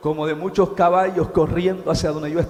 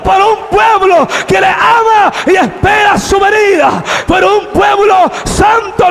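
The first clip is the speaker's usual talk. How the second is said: loud, high preacher's cry; full-force preaching; 13 semitones higher, 12 dB louder, slower than usual. Acted. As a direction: preach it out to the whole church.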